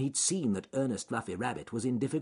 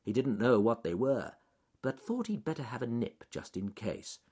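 usual speaking voice

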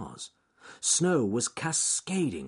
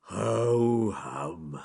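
long, drawn-out sleepy yawn; reluctant yawn; 4 dB louder than usual. A narrator's reading. Bear waking, yawning reluctantly. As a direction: yawn right through the words. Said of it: "very reluctantly he yawned".

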